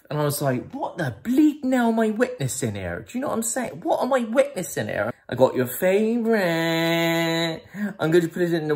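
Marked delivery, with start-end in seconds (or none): 0.7-5.1 high-pitched incredulous protest; appalled disbelief. Retold or imitated. Mate watching lovey-dovey couple, high appalled disbelief. Narrator's acted disbelief at the scene. 5.3-8.7 sing-song sweetheart. Acted. Lovey-dovey wine announcement, acted.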